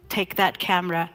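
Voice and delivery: ordinary delivery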